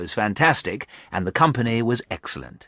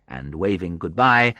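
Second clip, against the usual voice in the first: ordinary delivery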